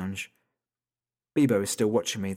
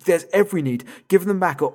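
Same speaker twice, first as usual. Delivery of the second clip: high, loud furious demand; angry shout; 8 semitones higher, 8 dB louder than usual. Acted. Father screams at the kidnapper to return his children. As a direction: roar it down the phone.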